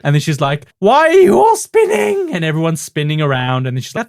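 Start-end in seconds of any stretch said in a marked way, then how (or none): 0.8-2.3 very high, loud mock bewilderment; woozy Julia Child parody. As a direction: wail dizzily in a TV cook falsetto. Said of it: "she's stumbling and she's slurring her words".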